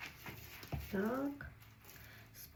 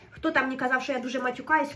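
ordinary talk, nothing marked

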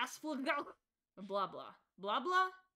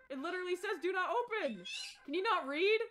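higher, sharp mocking rebuke; mock angry scold; 6 semitones higher, 3 dB louder than usual. Angry character scolding the player, high mock indignation. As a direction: scold like an offended shopkeeper.

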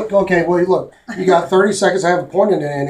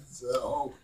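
ordinary talk

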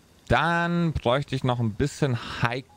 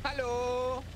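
from another room; 12 semitones higher, 9 dB quieter than usual. Acted. NPC answers from the toilet.

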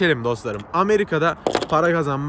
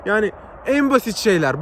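ordinary delivery

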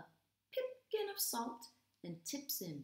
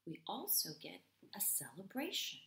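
ordinary delivery

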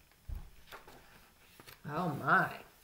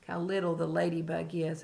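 usual speaking voice

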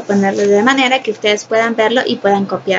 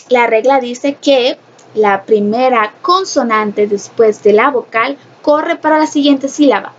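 normal talking voice

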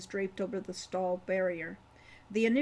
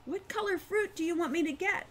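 from above; 9 semitones higher than usual. A narrator's reading. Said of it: "came Wren's voice from above".

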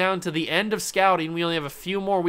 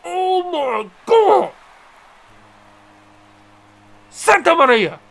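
screaming, much higher and louder; excited shout; 12 semitones higher, 9 dB louder, slower than usual. Real, not acted. Gamer screaming at a monster home run, stretched out. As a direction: yell in disbelief at the hit.

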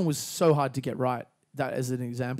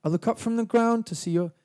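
English accent; 7 semitones higher than usual. Shown as a demonstration. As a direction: lift it gently, English style. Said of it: "sounds a little bit English".

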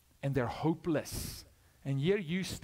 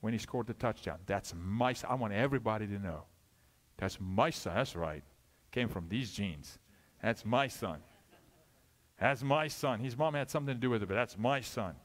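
proud father at his son's touchdown, lower voice; proud football father; 6 semitones lower than usual. Acted. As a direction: lower proud dad boasting. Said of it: "football father stand on the son and go".

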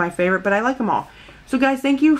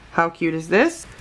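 normal talking voice

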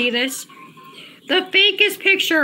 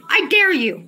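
normal talking voice

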